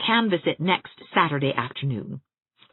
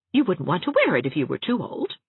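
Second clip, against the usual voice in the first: ordinary delivery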